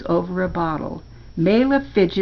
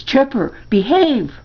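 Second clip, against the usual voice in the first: higher, sharp reprimand; scolding; 6 semitones higher, 4 dB louder than usual. A narrator's reading. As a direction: rein in a misbehaving dog. Boy reprimands his growling dog.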